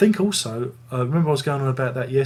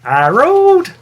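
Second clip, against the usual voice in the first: put-on much higher, shouted old-man screech of "Harold!"; Wilfred Brambell; 13 dB louder than usual. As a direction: bellow for Harold like a cranky old father. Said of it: "do my best Wilfred Brambell".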